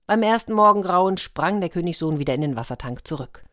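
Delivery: usual speaking voice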